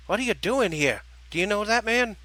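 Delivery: high-toned New Jersey character voice. Acted. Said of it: "with a slightly higher tone".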